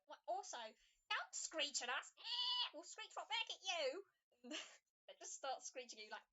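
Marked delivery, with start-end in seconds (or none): 0.1-4.0 high, loud indignant scolding; indignant squawk. Acted. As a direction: squawk back a threat. Creatures threaten to screech back.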